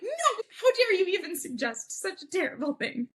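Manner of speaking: high-pitched, hammed-up indignation with laughter; mock outrage. Girlfriend rejects boyfriend's suggestion to quit, mock outraged. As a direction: protest in exaggerated scandalized horror.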